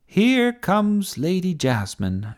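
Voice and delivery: high, drawn-out announcement; commentator's shout. A narrator's reading. Sophie announcing Jasmine's charge for TV, commentator's shout. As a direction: herald the fighter's entrance. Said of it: "shouted Sophie".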